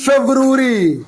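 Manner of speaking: "ফেব্রুয়ারি" as feb-ru-ri, no "ari"; ending shortened. Shown as a demonstration. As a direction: model "ফেব্রুয়ারি" without the a before final ri. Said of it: "এই আরি বাদ দিয়ে".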